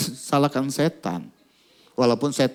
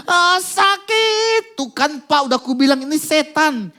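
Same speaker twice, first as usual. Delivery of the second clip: much higher, louder and slow, pained yelp turning accusing; pained cry; 13 semitones higher, 5 dB louder, slower than usual. Retold or imitated. The girl's father tells the pastor it is a demon, pained high cry. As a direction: cry out in pain, then blame the devil. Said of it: "kasihan, teriak".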